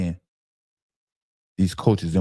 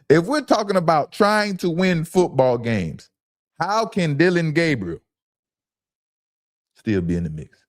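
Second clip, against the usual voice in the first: exasperated challenge; 7 semitones higher than usual. Real, not acted. Exasperated challenge to roster choice.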